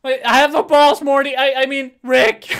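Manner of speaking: put-on high, very loud cracked-kid shouting; exaggerated squeaky cartoon voice; Morty. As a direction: blurt it high and loud, tripping over yourself. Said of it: "I talk like this because my balls".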